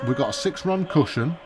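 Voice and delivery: ordinary talk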